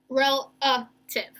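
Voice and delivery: "Relative" slow, a small break between syllables; three separate syllables. Shown as a demonstration. Teacher recapping the breaks, "Relative" in three slow syllables. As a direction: say "Relative" as three distinct beats. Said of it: "broke it up into three syllables".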